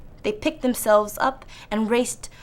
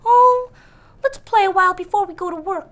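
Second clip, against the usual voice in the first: playful piglets; 11 semitones higher, 8 dB louder than usual. Acted. Lazy brothers begging to play.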